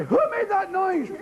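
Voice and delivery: a hint of a stern schoolmistress; stern teacher. Teacher demanding who made the noise, mock stern.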